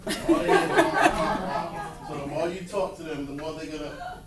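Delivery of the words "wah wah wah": muffled trombone-like wah wah, laughing; Charlie Brown teacher. Retold or imitated. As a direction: mimic the droning adult in the cartoon. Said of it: "you become Charlie Brown teacher".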